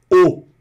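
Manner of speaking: "O" as one closed vowel, high and loud; closed O sound. Shown as a demonstration. Teacher models the sound of A plus U, "O" as closed o. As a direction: say "O" with a firmly closed o. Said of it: "é um O fechado mesmo".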